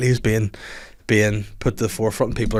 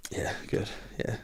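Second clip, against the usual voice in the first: breathy unvoiced contented whisper; whispered satisfaction; 14 dB quieter than usual. Coworker after the toilet, whispered contentment. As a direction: whisper it like a relieved sigh. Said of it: "would go in and go".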